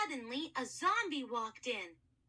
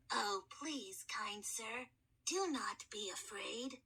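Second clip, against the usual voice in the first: lower, softer, polite ancient mummy; a boy's voice unused for millennia; gentle, courteous mummy soothing a scared boy; hoarse mummy kid; 4 semitones lower, 7 dB quieter, slower than usual. Acted. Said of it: "a kid with a very hoarse voice".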